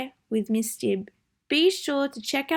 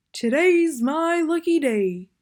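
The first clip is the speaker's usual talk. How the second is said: Bear's voice; 6 semitones higher, 5 dB louder than usual. Acted. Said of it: "said Bear".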